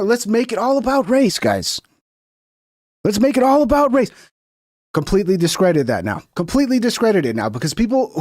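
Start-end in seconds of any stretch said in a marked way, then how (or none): 0.0-4.1 high, sneering mock; mock the race-baiter, pitched up. Mocking the opponent's push.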